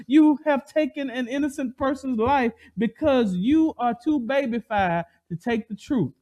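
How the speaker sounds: ordinary delivery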